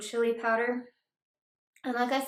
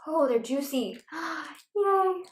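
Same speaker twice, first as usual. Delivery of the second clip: high delighted squeal; excited; 6 semitones higher, 3 dB louder, slower than usual. Real, not acted. First taste of crawfish.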